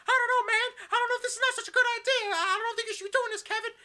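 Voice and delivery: frantic, very high, loud falsetto; hammed-up shrill worrier; Jimmy Hart. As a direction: squeal like a panicking wrestling manager. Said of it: "Jimmy Hart comes running in and he goes".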